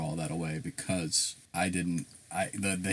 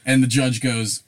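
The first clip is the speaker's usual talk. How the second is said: ordinary talk, nothing marked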